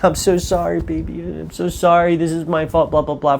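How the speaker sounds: mocking the sobbing couple, hammed up; mock tearful apology. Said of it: "they're crying".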